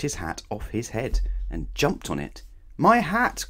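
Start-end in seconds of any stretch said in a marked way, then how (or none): 2.8-3.5 high, loud cry of dismay. Acted. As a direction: wail it out high. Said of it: "cried Mister Uppity".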